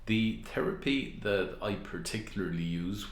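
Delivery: ordinary talk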